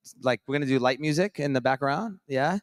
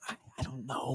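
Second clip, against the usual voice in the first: quiet; 2 semitones higher, 14 dB quieter than usual. Retold or imitated. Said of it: "I was like".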